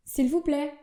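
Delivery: s'il vous plaît lifted up at the end; questioning tune. Shown as a demonstration. Getting the waiter's attention, questioning rise. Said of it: "d'une manière un peu plus interrogative".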